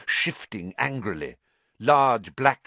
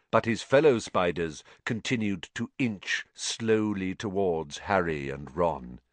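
usual speaking voice

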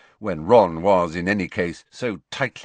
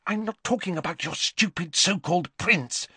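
put-on higher, scornful girl's voice; scornful Hermione; 10 semitones higher than usual. A narrator's reading. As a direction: dismiss the prince with contempt. Hermione snapping.

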